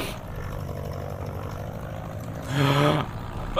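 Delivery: sharp shocked intake of breath; shocked gasp. Real, not acted. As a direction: gasp in alarm. Plane mishap.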